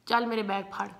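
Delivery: high, bossy Punjabi auntie voice; auntie impression. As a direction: play the pushy airport auntie asking for help. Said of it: "They're gonna be like".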